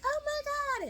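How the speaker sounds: very high, imitated girlfriend squeal; high-pitched gushing; mock excited squeal. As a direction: echo a thrilled friend way up high. Imitating friends seeing engagement ring.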